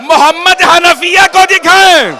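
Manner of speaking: loud, high, wailing preacher's lament; rising grief-stricken sermon, loud and slow; majlis recitation at full force. Acted. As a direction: cry the lament out to the mourners.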